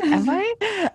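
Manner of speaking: high squeaky self-doubting "Am I?"; surprised disbelief. Retold or imitated. Questioning her own manifestation skills, mock surprised high. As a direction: pitch it up in amused disbelief.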